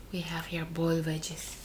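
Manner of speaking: soft whispered aside; whispering. Real, not acted. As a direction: whisper it to the viewers. Whispered aside while showing food.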